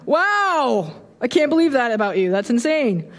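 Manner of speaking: high oh wow, then quick disbelief; hammed-up shocked friend reacting; mock astonished. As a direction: gasp like you can't believe it. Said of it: "They're like".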